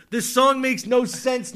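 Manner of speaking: ordinary talk, nothing marked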